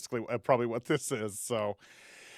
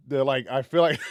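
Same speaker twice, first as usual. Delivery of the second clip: ordinary talk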